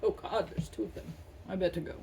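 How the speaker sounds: lightly voiced bear, low and soft; a hint of a startled bear muttering; bear's voice. The bear backing off, a lightly voiced low bear. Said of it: "the bear will probably go".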